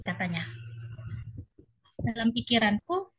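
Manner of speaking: usual speaking voice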